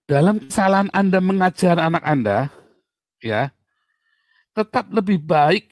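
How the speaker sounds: emphatic. Real, not acted. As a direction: press the point home to the parents.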